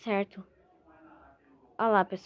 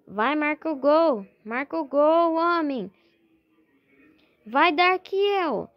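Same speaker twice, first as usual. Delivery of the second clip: explosive goal commentary; 2 semitones higher, 4 dB louder than usual. Real, not acted. Urging player, goal.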